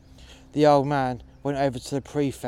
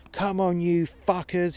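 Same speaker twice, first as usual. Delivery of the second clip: higher, defiant yell; shouted; 5 semitones higher than usual. Retold or imitated. Old man in front of the desk goading the troops, shouting. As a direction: goad them to come at him. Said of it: "he shouted".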